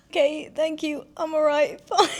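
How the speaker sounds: flustered, laughing reassurance; flustered laughing reply. Retold or imitated. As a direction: brush it off through giggles.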